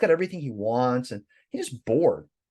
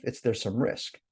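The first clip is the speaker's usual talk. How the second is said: usual speaking voice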